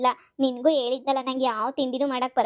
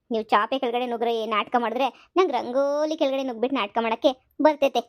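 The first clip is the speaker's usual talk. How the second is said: ordinary talk, nothing marked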